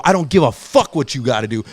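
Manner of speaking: re-enacted loud dismissal; angry retort. Acted retort in quarrel.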